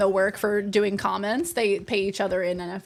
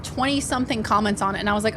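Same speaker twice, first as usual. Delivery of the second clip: usual speaking voice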